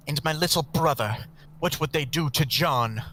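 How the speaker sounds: pleading woman. Acted. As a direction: plead for your brother.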